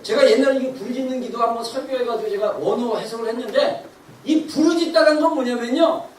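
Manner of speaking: ordinary talk, nothing marked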